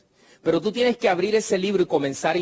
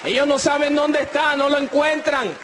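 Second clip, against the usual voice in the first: much higher, amazed voice; astonished woman; 10 semitones higher than usual. Acted. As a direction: let the wonder peak. Mujer sanada asombrada. (asombro total, voz mucho más aguda)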